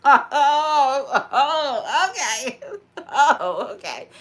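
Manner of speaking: laughing through a loud oh; real laughter spilling into the words. Real, not acted.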